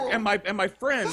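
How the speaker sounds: normal talking voice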